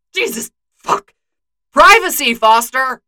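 high startled curse building to a loud yell; jump, then bellow for privacy; startled shout. Acted. Bucky startled by Jane, shouting at her for privacy.

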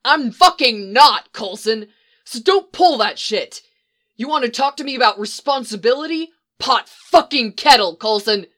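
heated furious snap; angry retort. Acted. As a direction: hurl it back at him. Angry quarrel.

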